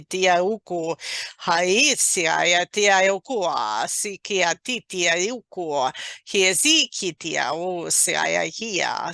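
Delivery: flowing, rhythmic stream of syllables; channeled light language. Acted. Channeler sharing energies with a listener, flowing light language. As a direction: chant the unknown tongue in rolling phrases.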